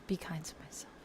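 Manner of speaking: soft whispered repeat to herself; whispering. Shown as a demonstration. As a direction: whisper it gently, like a reminder.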